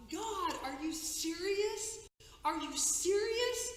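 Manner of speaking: high, slow, stunned delight; can't believe her luck, drawn out; amazed disbelief. Retold or imitated. Finding a second antler, amazed.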